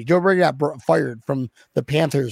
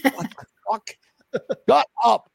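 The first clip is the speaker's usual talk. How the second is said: mock outrage; 6 semitones higher than usual. Mock outrage at run call.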